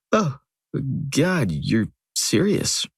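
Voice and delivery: slow, dawning surprise; slow realization. Retold or imitated. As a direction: let it sink in slowly. Realizing line, slow, different timbre.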